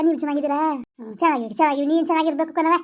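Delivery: usual speaking voice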